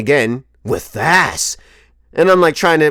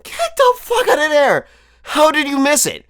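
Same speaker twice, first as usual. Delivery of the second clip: indignant shout; 14 semitones higher than usual. Retold or imitated. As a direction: shout it at the friend who missed it. Disbelief friend missed it.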